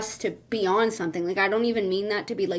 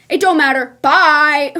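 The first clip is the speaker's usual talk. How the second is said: loud, raised mock brush-off; dismissive; 3 semitones higher, 8 dB louder than usual. Girl refusing the guy's attempt to save it, dismissive. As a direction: cut him off with a loud goodbye.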